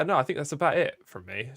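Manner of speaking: ordinary delivery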